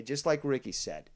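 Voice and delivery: ordinary talk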